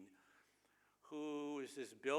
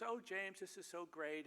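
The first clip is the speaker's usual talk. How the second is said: higher, swooning cartoon wife; lightly voiced Marge, higher and dreamy; mock Marge; 8 semitones higher than usual. Marge on the horse coos to James, in a higher, lightly voiced mock Marge.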